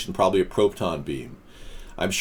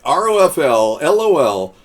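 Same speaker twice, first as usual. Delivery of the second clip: loud, higher spelled-out letters; quoted mocking commenter; 5 semitones higher, 7 dB louder than usual. Host reading a viewer's chat jeer, loudly mocking. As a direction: call out each letter with mock hilarity. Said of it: "the guy who was saying".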